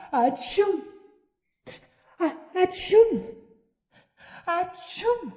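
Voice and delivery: big high theatrical sneezes; sneezing. Acted. Puppeteer sneezes repeatedly.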